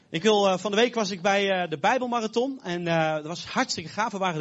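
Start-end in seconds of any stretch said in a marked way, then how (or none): none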